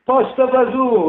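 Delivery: high, slow, hammed-up Italian accent; playful fake Italian voice for the bees; mock Italian. Host voicing friendly Italian bees, hammed-up Italian accent. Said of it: "they're like".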